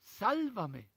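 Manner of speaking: loud, high cry for rescue; desperate cry. Acted. Sinking Peter cries out to Jesus, a loud desperate shout. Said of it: "Y grita".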